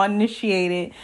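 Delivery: ordinary delivery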